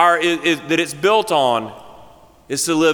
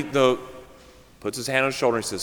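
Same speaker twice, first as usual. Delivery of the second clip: ordinary talk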